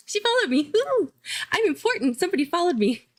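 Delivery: high, gushing little celebration; high-pitched fan excitement, easing slightly at the end; giddy excitement. Real, not acted. Gushing over a new follower.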